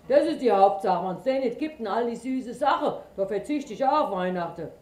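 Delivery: loud, quick grumbling bear; bear folk. A narrator's reading. Bear keeps speaking.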